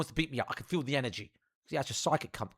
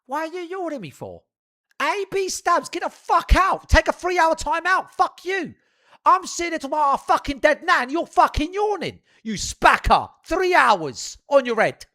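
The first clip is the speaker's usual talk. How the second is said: loud, high-pitched outraged rant; angry shouting; 10 semitones higher, 10 dB louder than usual. Real, not acted. Streamer tearing into a viewer for yawning, furious shouting. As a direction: yell it at a viewer who has offended you.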